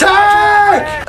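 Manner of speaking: sudden shout of "Tank!" high and loud. Real, not acted. Gamer yelling "Tank!" at a tank. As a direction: bellow "Tank!" with glee. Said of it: "I just can't stop saying tank loudly".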